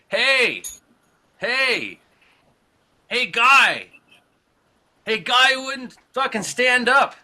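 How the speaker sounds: high, loud imitated holler; heckler calling out. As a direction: call out in bursts to someone rows away. Acted heckler calling from rows behind.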